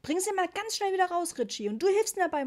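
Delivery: loud, high-pitched mother giving orders; firm command. A narrator's reading. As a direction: take charge loudly and urgently. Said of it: "sagte Mama bestimmt". (bestimmter, lauter Befehl)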